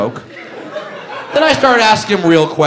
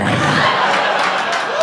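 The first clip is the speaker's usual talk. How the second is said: much higher, breathless wheezing laugh; high wheezing mock laugh. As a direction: squeak out a helpless laugh. Wheelchair man dying laughing.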